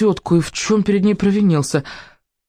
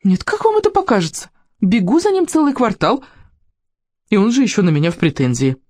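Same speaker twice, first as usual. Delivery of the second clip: indignant saleswoman; 4 semitones higher than usual. Acted.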